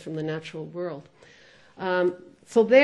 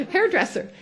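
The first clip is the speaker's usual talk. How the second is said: hairdresser said much higher through a laugh; 8 semitones higher than usual. Shown as a demonstration. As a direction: laugh out the word hairdresser, pitched way up.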